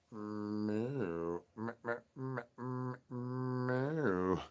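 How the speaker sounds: soft, quiet mewing cat; cat meow. Pretend. Cat answering the El Wushy call, soft meows. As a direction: mew back like a shy cat.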